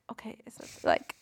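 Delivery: whispered thinking to oneself; hushed, voiceless self-questioning; doubtful whisper. Retold or imitated. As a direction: puzzle it out under your breath. Acting out own doubt over spelling.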